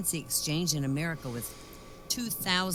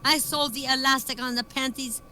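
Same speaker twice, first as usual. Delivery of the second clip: angry protest; 9 semitones higher, 5 dB louder than usual. Retold or imitated. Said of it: "yells Ole".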